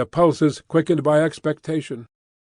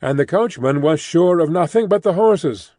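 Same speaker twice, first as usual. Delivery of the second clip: ordinary delivery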